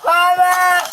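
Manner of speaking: loud desperate yell; shout. Real, not acted. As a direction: yell out for mama. Drunk woman yells during arrest.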